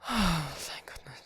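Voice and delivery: long, slow sigh of relief; relieved. Acted. Finding the partner is not badly hurt, relieved. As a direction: breathe the words out, slowly, relieved.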